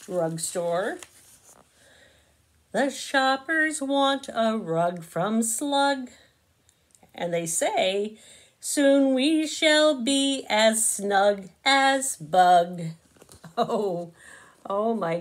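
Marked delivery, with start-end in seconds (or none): 2.7-12.8 slow, lilting storybook narration; reading to children. A narrator's reading. Narrator reading Bug in a Rug to kids, slow and sing-song. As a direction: savour each rhyme for little listeners.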